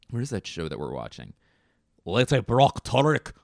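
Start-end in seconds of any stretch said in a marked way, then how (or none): 2.0-3.3 higher, louder Scottish burr; Scottish accent. Acted. Recalling a Scottish TV show, Scottish accent. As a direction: put on a broad Scottish lilt. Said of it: "Scottish accent".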